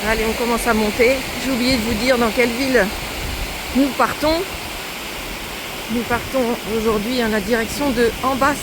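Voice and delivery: talking up loud over the wind outdoors; loud, cheerful narration while walking. Real, not acted. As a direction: project it so the camera catches it. Talking loudly to camera outdoors.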